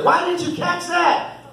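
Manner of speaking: put-on slow, high child's scolding voice; imitating a little sister. Little sister nagging her brother, slow high child voice. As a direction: lightly mimic a kid nagging her brother. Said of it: "telling her brother".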